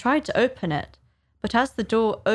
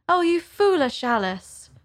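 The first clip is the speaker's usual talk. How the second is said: put-on higher, mildly reproving girl's voice; raised pitch, chiding herself; Alice self-scolding; 8 semitones higher than usual. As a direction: gently tell yourself off.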